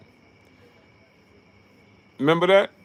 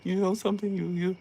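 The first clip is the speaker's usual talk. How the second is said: mock Robert Garcia; 12 semitones higher than usual. Freddie Roach walking over to Robert Garcia, high and mumbling. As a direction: fumble the words, then confront in a high voice. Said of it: "He came over there like".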